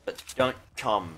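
ordinary delivery

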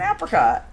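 APRICOT with a short A as in apple; short-A pronunciation. Shown as a demonstration. Streamer insisting on her own pronunciation, apricot with short A. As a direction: say "apricot" with the vowel of cat first. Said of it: "that's how I say it".

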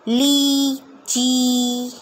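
लीची with the ई held long and very slow; long ee drill. Shown as a demonstration. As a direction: drag out the ee in लीची. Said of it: "ई करके।".

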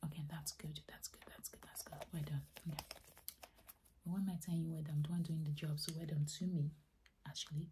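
ordinary talk, nothing marked

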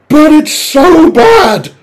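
high, slow anguished cry of despair; drawn-out exasperated whine; exasperated wail. Real, not acted. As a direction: wail it out in mock agony. Exasperated outburst about film.

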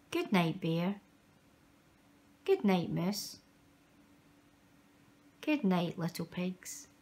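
soft good nights. A narrator's reading. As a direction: tuck them in softly. Saying goodnight to sleeping characters.

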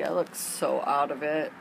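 ordinary talk, nothing marked

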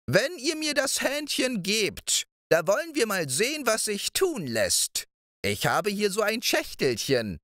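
high, coaxing crone voice; scheming old witch. A narrator's reading. Witch flattering Balthasar for the hen, high coaxing crone voice. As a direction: tempt him like a sly old witch.

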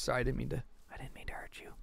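soft whispered apology. Real, not acted. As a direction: whisper it gently. Apologizes to game character.

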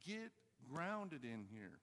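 ordinary delivery

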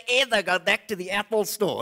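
usual speaking voice

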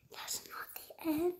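breathless whisper of disbelief; stunned hushed whisper; disbelieving whisper. Real, not acted. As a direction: whisper it as if you can't believe it. Same words, now whispered.

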